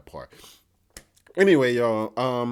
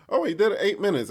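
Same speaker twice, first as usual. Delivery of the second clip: ordinary delivery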